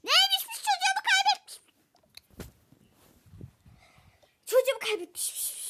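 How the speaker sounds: high loud mocking voice; mocking quote. Mother mocking what the caller said, scornful.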